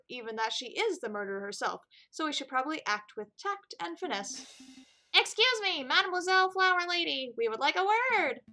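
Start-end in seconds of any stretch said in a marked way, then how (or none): 5.1-8.4 loud, higher call across the market. Acted. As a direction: hail her loudly, no tact. Calling out to flower seller.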